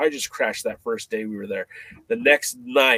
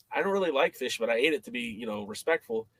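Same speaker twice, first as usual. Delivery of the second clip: normal talking voice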